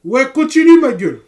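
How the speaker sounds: screamed high and very loud; hype shout. Real, not acted. Streamer screaming encouragement at his companion in game. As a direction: cheer your buddy on at full volume.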